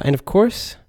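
ordinary talk, nothing marked

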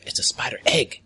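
hushed whispered reveal. Acted. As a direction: whisper it like a secret. GM reveals the surprise.

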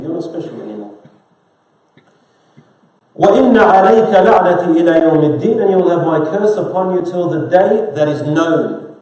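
loud, driving sermon voice; preaching at full force. Acted. Imam preaching to the congregation, forceful. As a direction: preach it hard to the hall.

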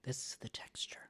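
breathy ASMR whisper. Retold or imitated. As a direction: whisper it close to the mic. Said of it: "they'll be like".

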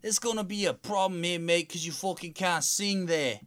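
high Cockney-ish judge voice, way up; bad Cowell impression in a Cockney accent; Simon Cowell impression. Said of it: "It's me, Simon Cowell".

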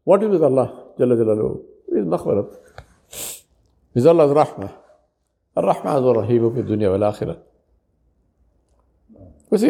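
ordinary delivery

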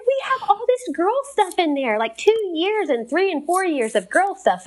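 very high, hammed-up distress; mock freaking out. Wife protesting to husband about the girl's room, mock freak-out. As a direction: wail it high in mock panic. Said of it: "I'm freaking out".

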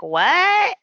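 high startled what; mock shocked. Liam shocked at news of his transfer, high startled squeak. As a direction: squeak it out in disbelief. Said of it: "And he's like".

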